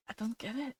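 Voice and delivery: hushed, barely audible quote; super quiet. Retold or imitated. Streamer re-enacting a favourite comedy skit, super quietly. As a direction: say it super quietly, far under your voice. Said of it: "Super quietly".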